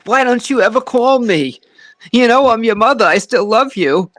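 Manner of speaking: high, loud guilt-tripping mom voice; nagging mother. Retold or imitated. Son imitates his mother nagging him to call, high and loud. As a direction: play the mother scolding her son for not calling. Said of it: "I would have to go through a half an hour of".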